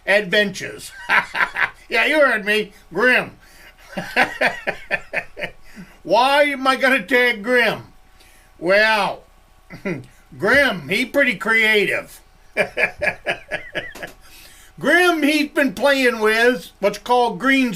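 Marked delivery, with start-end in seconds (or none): 3.9-5.5 a sudden high-pitched giggle; high squeaky laugh. Real, not acted. Laughing about tagging Grim, a high squeaky giggle. 12.5-14.0 a sudden high-pitched giggle; high squeaky laugh. Real, not acted. Laughing about tagging Grim, a high squeaky giggle.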